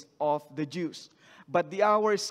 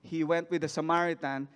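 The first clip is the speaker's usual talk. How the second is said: ordinary delivery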